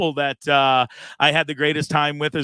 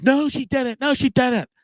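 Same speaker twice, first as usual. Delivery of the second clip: mocking the manager; 9 semitones higher, slower than usual. Manager denies the comped meal.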